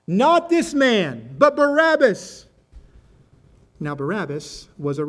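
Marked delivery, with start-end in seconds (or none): 0.1-2.4 loud, high, drawn-out crowd shout; shouted back. A narrator's reading. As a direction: shout it back slowly as an angry mob. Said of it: "they shouted back".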